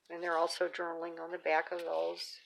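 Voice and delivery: normal talking voice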